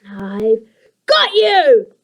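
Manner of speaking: loud, slow triumphant line. A narrator's reading. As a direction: savour the catch. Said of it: "Lucy thought".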